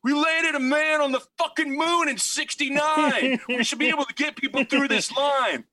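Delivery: high, hammed-up outraged yell; mock a fed-up New Yorker yelling out; retold angry yell, pitched high; yelling guy. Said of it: "he just yells out".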